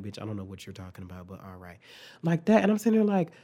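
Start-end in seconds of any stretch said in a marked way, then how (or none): 0.0-1.8 very soft, low, quick muttered aside; blank, baffled look. Retold or imitated. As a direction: trail off low, imitating baffled compliance. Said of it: "she had that look on her face like".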